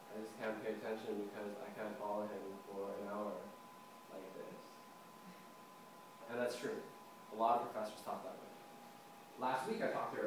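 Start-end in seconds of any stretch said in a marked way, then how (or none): 0.1-4.6 low, droning mimicry of a boring voice; mock monotone drone. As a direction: keep it low and soft on one note. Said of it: "talks in monotone like this".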